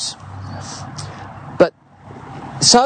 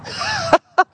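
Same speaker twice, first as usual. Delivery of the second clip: laugh. Real, not acted. Laughs after a funny line.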